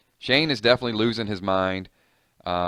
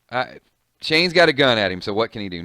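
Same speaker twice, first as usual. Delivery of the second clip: ordinary delivery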